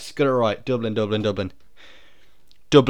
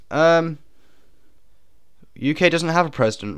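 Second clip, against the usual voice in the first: usual speaking voice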